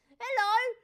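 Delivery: high, very loud mock hello; mock goading shout. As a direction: holler it like prodding a performing monkey. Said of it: "treat me a little bit like a dancing monkey".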